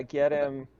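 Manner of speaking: ordinary delivery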